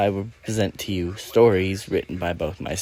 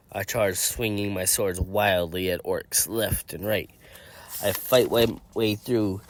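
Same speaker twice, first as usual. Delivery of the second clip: usual speaking voice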